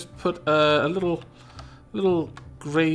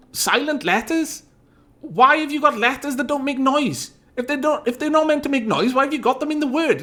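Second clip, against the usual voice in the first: exasperated rant; 10 semitones higher than usual. Real, not acted. Streamer venting to chat about silent letters, exasperated and higher. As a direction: demand an answer from English spelling itself.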